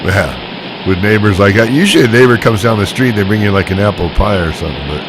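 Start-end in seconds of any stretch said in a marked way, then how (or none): none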